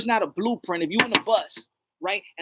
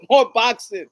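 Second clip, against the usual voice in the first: loud, raised comic call; mock rallying call; 5 semitones higher, 8 dB louder than usual. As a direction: call it out like a hyped-up demand. Repeats a joking call for boxing.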